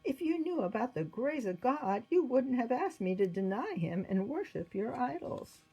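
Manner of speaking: high, earnest proclamation; impassioned public declaration; declaring for all to hear. A narrator's reading. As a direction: testify boldly to the whole court. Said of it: "said for all to hear".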